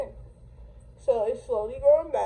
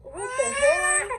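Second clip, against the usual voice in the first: high, slow stunned what the hell; disbelief; 13 semitones higher, slower than usual. Retold or imitated. Narrator reacting to being blocked, high slow disbelief. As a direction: stretch it out in shock. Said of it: "And I'm like".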